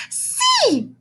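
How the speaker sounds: very high delighted "C!"; a teacher thrilled by a letter; exclaimed. Acted. Teacher exclaims at the boy's answer, very high. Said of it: "exclaimed Miss Fairchild".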